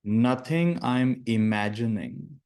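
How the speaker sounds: slow, deliberate meditation phrases with long silences; guided meditation. Acted. Teacher giving students lines to repeat in their minds, slow meditative delivery. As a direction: lead them slowly through each phrase, leaving space to repeat it.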